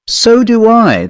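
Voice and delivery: a dismissive huff at the idea; snorted. A narrator's reading. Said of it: "the large commercial snorted".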